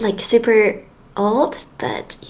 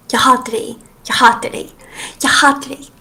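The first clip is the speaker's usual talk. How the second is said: mock hate rant; 6 dB louder than usual. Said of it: "This sounds like some hate speech".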